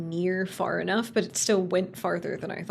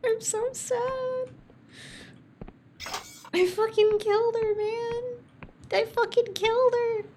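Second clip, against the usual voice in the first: much higher hammed-up weeping; mock sobbing; 12 semitones higher than usual. Gamer mourning the bean they killed, high mock sobbing. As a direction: blubber it out.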